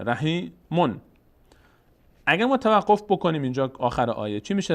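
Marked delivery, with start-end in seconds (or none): 0.0-1.0 رحیمون split before a distinct "mon"; tanween "on" sounded. Shown as a demonstration. Teacher points out the verse's last word, رحیمون with clear "-mon".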